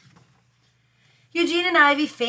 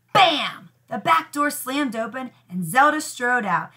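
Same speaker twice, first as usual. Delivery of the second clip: usual speaking voice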